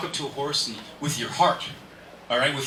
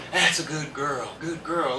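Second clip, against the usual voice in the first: praising horse; slower than usual. Shown as a demonstration. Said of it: "they listen".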